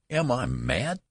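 a father's terror turning into fury; read-aloud outburst, mildly thundered; thundering rage. Acted. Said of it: "thundered Mister Button".

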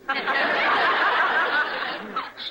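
high, loud ecstatic laugh. Acted. Marchbanks laughing at Morel's interruption, ecstatic laugh. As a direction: burst out laughing, giddy.

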